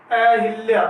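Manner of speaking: ordinary delivery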